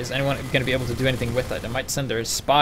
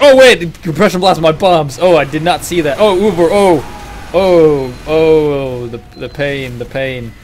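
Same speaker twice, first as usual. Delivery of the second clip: loud, high mock panic; hammed-up panicking player; 4 semitones higher, 12 dB louder, slower than usual. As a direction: ham up a player in agony. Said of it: "It's like".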